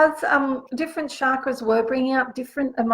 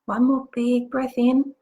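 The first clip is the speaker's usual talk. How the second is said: guided meditation. Acted. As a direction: guide them gently into the visualization. Leads a guided visualization.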